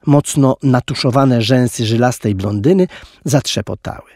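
usual speaking voice

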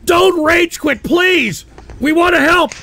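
high-pitched, insistent begging; urgent pleading. Real, not acted. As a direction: beg her to stay. Begging her not to quit.

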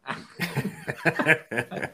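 high breaking-up laugh; amused laugh. Real, not acted. Man laughing at his kidnapper impression, amused laugh. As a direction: crack up at your own impression.

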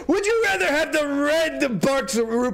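high, slow, whimpering mock voice; mock whiny readout. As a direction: whine the chat's question like a crybaby. Reading chat message in a voice.

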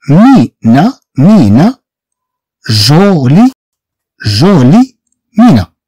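مينا, ميكي, جولي read out syllable by syllable; word drill. Shown as a demonstration. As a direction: model the words مينا and جولي for the class. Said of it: "عندنا هنا مي". (قراءة نموذجية لـ مينا و جولي)

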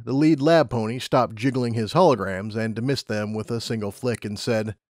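usual speaking voice

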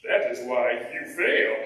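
low, lightly voiced Yoda; Yoda impression. Wise Yoda answering awed Luke, low Yoda voice. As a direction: deliver it like the wise little master. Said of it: "looks at him and says".

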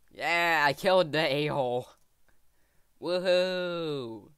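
loud triumphant whoop; real gamer victory yell; victory cheer. Real, not acted. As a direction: celebrate the kill out loud. Cheering after killing the boss.

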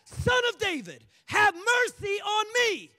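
high, loud cry for mercy; desperate beggar hollering out; shouted plea. Acted. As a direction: shout it over a crowd. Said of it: "The Bible says he shouted".

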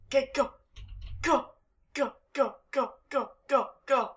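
high, loud urgent go. Real, not acted. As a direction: push yourself on. Sprinting out in game.

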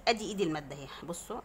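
ordinary delivery

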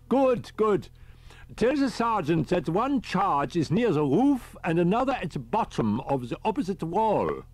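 put-on high, fussy elderly professor; a high old professor calling on the radio; professor's voice. A narrator's reading. Riker gives instructions.

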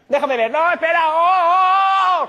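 high, very loud drawn-out yell; hammed-up panicked cry, stretched long; falling scream. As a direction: yell as if toppling off a ladder. Acts friend falling off the ladder.

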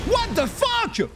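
high, slow stunned cry; shocked exclamation. Real, not acted. Streamer seeing something bizarre, high stunned outcry. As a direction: gasp it out in disbelief. Shocked at strange game event.

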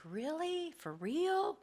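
mock inner sigh. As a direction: play the exasperated inner voice lightly. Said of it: "it's that inward sighing".

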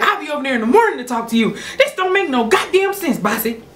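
rapid, high-pitched scolding; acted-out dad fuming, rushing the words; angry father. Dad scolding daughter before hanging up, angry.

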